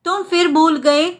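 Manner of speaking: ordinary talk, nothing marked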